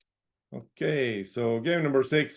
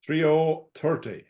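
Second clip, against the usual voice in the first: calling each number out, then repeating it; sing-song bingo number calls; bingo caller. Acted.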